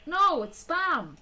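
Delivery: slow, high drawn-out correction; exasperated. Real, not acted. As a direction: drag it out, exasperated.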